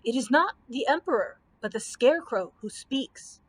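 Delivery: plain reading voice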